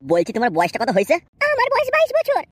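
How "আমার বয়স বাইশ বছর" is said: put-on much higher, slow girlish voice; coy young bride. Bride asking the matchmaker where to sit, high coy voice. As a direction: play the shy village girl.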